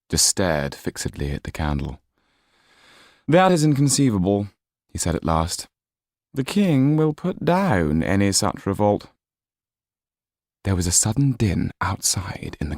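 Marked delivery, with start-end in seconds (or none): 3.3-4.5 high, disdainful aristocrat voice; haughty Marquis. Acted. 6.3-9.1 high, disdainful aristocrat voice; haughty Marquis. Acted.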